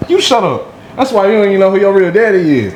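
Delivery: bully taunting him in class, high and fast. Retold or imitated. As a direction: throw the insult like a cocky kid.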